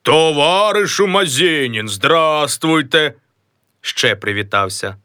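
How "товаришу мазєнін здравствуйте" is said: slow, changed comrade's voice; a slow, drawn-out comrade greeting; third voice. Acted. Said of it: "Ще привітався на третій голос".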